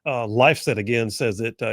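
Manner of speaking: usual speaking voice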